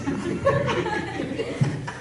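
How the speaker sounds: high breathless laugh. Real, not acted. Preacher laughing at the man's fear. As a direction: burst out laughing at the tale.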